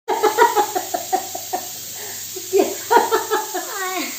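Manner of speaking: can't stop laughing at her husband; long laugh. Real, not acted. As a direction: break into a long helpless laugh. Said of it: "मुझे भी इतनी हंसी आ रही है ना".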